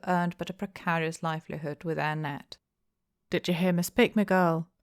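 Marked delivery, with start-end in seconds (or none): none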